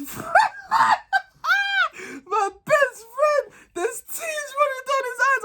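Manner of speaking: high, slow words squeezed out through laughter; laughing so hard the words go high and thin; cracking up mid-sentence; laughing while telling. Real, not acted. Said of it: "I burst out laughing".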